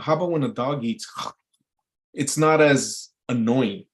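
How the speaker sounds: ordinary talk, nothing marked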